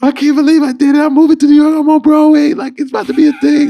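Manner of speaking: overwhelmed joyful crying to a friend; bawling happy tears. Retold or imitated. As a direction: cry it out over Skype to a friend far away. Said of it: "just bawling on the, on Skype, like, crying".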